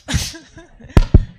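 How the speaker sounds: high loud burst of laughter; shy laugh. Real, not acted. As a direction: let out a bright giggle. Laughs before her greeting.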